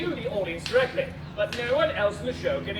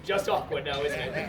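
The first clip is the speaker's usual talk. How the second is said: low deadpan aside; 10 semitones lower, 4 dB quieter than usual. Acted.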